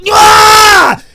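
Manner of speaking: long, high, loud cry of revival; triumphant scream. Retold or imitated. Little Joey back the next day, triumphant scream. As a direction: scream like springing back to life.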